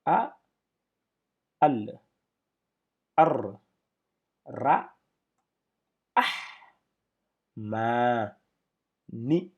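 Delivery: each Arabic syllable called out loud and separate. Shown as a demonstration. Teacher sounding out Arabic syllables to students, loud and separate. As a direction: spell out the syllables one at a time, loud. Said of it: "Donc, nous avons".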